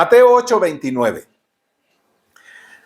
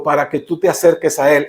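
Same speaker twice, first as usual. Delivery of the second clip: ordinary talk, nothing marked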